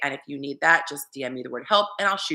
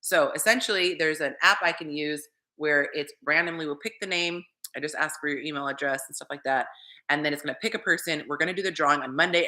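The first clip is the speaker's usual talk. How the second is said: normal talking voice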